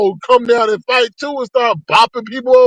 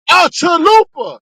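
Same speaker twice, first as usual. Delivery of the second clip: high, slow, indignant shout; outraged shout; 8 semitones higher, 3 dB louder, slower than usual. Real, not acted. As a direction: hammer it home in disbelief. Outraged repeat in rant.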